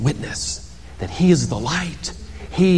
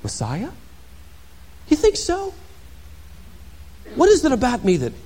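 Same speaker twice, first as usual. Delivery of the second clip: coy, fishing for praise, trailing softer; mock false modesty; 3 semitones lower, 7 dB quieter than usual. John imagined answering the priests, coyly falsely modest. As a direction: play at humble while flattered. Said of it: "He could have answered".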